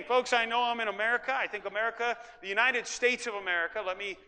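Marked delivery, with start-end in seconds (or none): none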